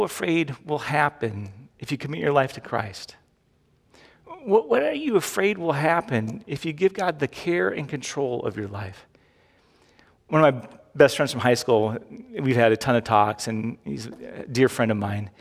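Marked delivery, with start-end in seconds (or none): none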